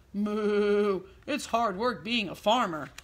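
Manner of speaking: lightly voiced cow complaining; mooing cows. A narrator's reading. Cows agreeing the work is hard, lightly mooing. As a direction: moo like a weary cow. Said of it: "the cows all agreed".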